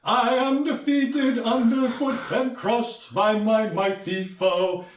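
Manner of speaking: high, slow, theatrical lament; mock defeated warrior. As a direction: ham up the fallen hero. Sagoro, lying defeated.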